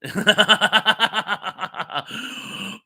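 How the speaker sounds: long, loud run of ha-ha laughter; big laugh at own story; real laugh. Real, not acted. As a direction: burst out in rapid staccato laughing. Laughs after translating the phrase.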